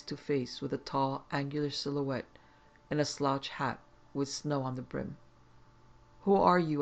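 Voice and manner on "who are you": startled and sharp. A narrator's reading. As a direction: blurt it out in shock. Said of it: "I jerked out, startled".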